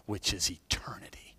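hushed, breathy whisper on eternity. Real, not acted. Pastor whispers eternity to the congregation, hushed. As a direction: drop to a whisper for the promise.

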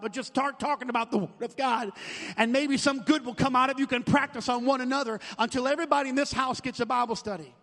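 loud, rising sermon voice; full-force preaching. Acted. Pastor urging the church to sign commitments, loud preaching. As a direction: drive it home to the congregation.

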